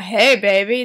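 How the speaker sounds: mock shout. Weirdo shouting at a girl across the room, mock shout. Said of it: "weirdo shouting across the room".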